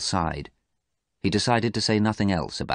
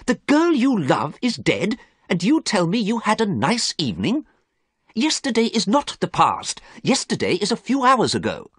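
high, indignant remonstration; appalled friend, pitched right up; shocked reproach; 4 dB louder than usual. A narrator's reading. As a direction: reproach him in disbelief.

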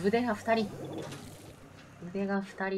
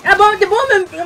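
startled shout; 12 semitones higher, 13 dB louder than usual. Real, not acted. Bomb hits him suddenly.

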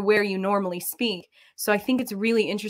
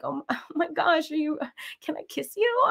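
higher-pitched mock gushing; 7 semitones higher, 4 dB quieter than usual. Contestants hugging on the dating show, mock gushing. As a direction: imitate a giddy, lovestruck contestant with a laugh.

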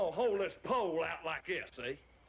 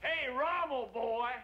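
raised, louder holler; loud, higher-pitched call; 4 semitones higher, 5 dB louder, slower than usual. Acted. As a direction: hail someone across a distance. Calling out to Rommel.

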